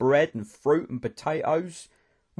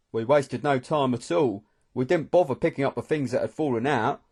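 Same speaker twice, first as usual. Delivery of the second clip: ordinary delivery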